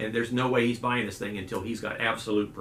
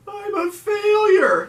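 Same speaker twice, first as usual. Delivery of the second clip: very high, loud, slow mock sob; tearful wail; 10 dB louder, much slower than usual. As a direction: wail it like a crying girl at graduation. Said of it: "And she said".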